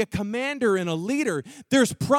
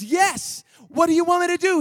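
higher eager reply; eager Moses; 8 semitones higher than usual. Acted. Moses answering God's call, eager and higher. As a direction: jump at the chance, higher.

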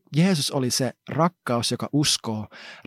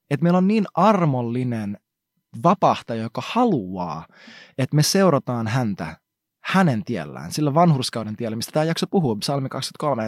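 ordinary talk, nothing marked

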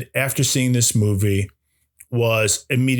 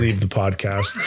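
ordinary talk

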